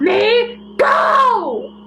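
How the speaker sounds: high, frantic shout. Acted. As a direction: scream to be let go. Captured girl struggling to escape.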